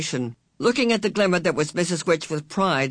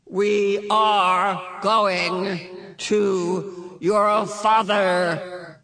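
very slow, drawn-out, higher unearthly voice; otherworldly ringing voice; 4 semitones higher, much slower than usual. A narrator's reading. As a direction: ring out each word like a distant being. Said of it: "Missus Witch said".

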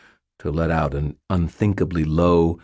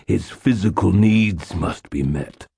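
ordinary delivery

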